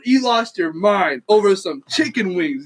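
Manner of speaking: ordinary talk, nothing marked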